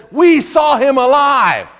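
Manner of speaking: a touch of wonder, drawn out; mild excited announcement. Retold or imitated. Disciples telling Thomas the news, lightly excited.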